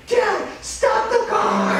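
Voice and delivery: put-on high, loud drawn-out child's yell; squealed kid shout, slow and very high; insistent little boy. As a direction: holler it as an insistent small son. Small son insists more loudly.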